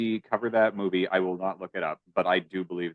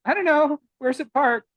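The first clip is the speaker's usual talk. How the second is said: very high mock girlish voice; Bunny; 4 dB louder than usual. Bunny answering a pickup line, high put-on voice. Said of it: "And she says".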